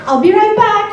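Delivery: high, loud excuse to escape; squeaky excuse. Retold or imitated. Exposed faker excusing herself from the guys, high loud voice. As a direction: pitch it way up and bail out. Fleeing the bar after exposure.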